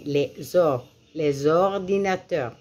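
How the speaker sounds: "les ordinateurs" broken off at "les or-", then whole, joined by Z; liaison demonstration. Shown as a demonstration. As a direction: link "les" to "ordinateurs" with a clear Z. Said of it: "J'ai fait la liaison".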